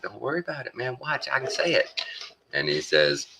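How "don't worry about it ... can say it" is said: higher, light mock voice, brushing it off; carefree streamer. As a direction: toss it off like a guy showing off. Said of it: "They're like".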